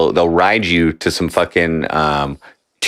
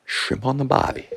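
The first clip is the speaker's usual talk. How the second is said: softer, higher mock Australian accent; 4 semitones higher, 7 dB quieter than usual. Echoing friend's shrimp line, soft mock Australian accent. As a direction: echo it quietly in Australian accent. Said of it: "That's Australian".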